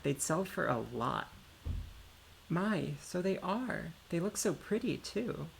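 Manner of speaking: slow, lilting, admiring character voice; wide swoops of pitch, marvelling at the find; eager game character. Acted. Character admiring the mirror rocks to a companion, eager and lilting.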